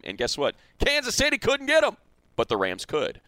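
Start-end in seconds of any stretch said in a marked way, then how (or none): 0.8-2.0 put-on very high Texas-accented punchline; thick Texas accent. Host turning a coach's punchline on Kansas City, Texas accent. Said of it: "in his thick Texas accent".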